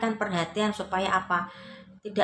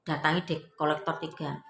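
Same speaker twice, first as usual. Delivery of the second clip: ordinary talk, nothing marked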